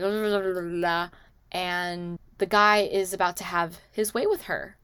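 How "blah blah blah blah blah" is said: put-on slurred drunk babble. Drunk woman babbling at the guy, slurred. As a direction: imitate drunken slurred words. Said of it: "she's slurring her words".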